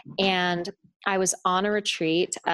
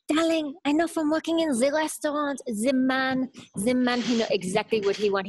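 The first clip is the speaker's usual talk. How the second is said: imitated French boyfriend, pitched up; thick French accent; 6 semitones higher than usual. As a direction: play the Frenchman explaining in broken English. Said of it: "this thick French accent".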